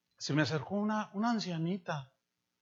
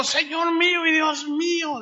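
Thomas's confession; 9 semitones higher, 8 dB louder than usual. Retold or imitated.

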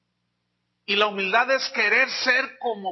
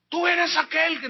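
high, louder eager asking; questioner; 8 semitones higher, 5 dB louder than usual. Acted. Crowd asking John, a higher, louder eager question. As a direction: press him with the question.

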